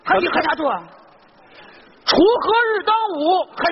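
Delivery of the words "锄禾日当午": slow recital. Acted. Recites poem as password.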